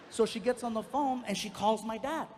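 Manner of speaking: ordinary delivery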